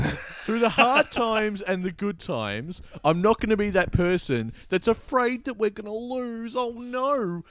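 high, slow, grandiose pledge; mock heroic. Fan retelling his loyal vow to co-host, mock grand. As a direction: ham up the loyal fan's vow.

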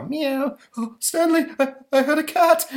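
high, loud whimpering monster; scared monster. Acted. Monster frightened by a cat, very high and loud. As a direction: squeal at the cat.

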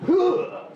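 sharp high effortful grunt. Shown as a demonstration. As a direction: grunt like hurling trash. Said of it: "a grunting sound".

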